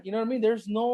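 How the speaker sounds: usual speaking voice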